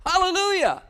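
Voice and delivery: a ringing hallelujah; joyful hallelujah. Real, not acted. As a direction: burst out with praise. Shouted praise after verse.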